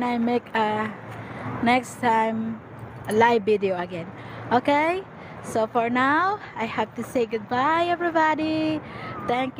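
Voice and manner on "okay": ordinary talk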